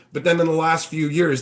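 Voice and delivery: ordinary delivery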